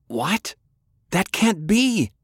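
high, drawn-out cry of disbelief; shocked disbelief. A narrator's reading. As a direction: refuse to believe it, voice shooting up. Hears grandfather was cursed.

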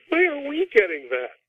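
lightly mocking, high-pitched bewildered analyst voice; baffled NSA analyst. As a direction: play the stumped spy agency. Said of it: "looking at and saying".

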